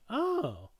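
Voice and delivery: high-pitched "oh" of discovery; intrigued realisation. Retold or imitated. Viewer hearing another Spider-Man's version, intrigued. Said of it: "I'm like".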